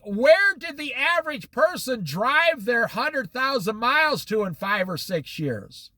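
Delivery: normal talking voice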